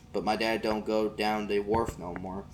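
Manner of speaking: plain reading voice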